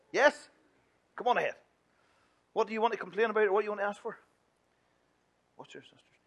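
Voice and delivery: normal talking voice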